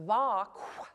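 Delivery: "vaqua" slowly, ending in a breathy kwh release. Shown as a demonstration. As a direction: let air rush out after the K of "vaqua". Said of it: "this one has kwa at the end".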